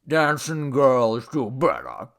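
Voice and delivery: slow tipsy grumble; grumpy. A narrator's reading. Drunk god after being kissed, grumpy. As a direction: slur it sulkily. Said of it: "he said grumpily".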